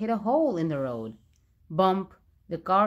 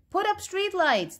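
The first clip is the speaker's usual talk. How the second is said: high protest yell; angry yell; 13 semitones higher than usual. A narrator's reading. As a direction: demand it from the crowd. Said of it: "they yelled".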